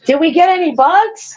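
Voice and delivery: grandma asking grandson about bugs. Real, not acted. Asks toddler about bug hunt.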